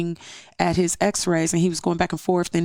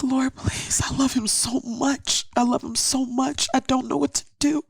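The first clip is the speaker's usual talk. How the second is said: high, pleading cry to God; tearful prayer; 8 semitones higher than usual. Retold or imitated. Mother at the altar pleading for her son, high tearful plea. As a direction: plead for him, close to tears. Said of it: "crying out for my baby".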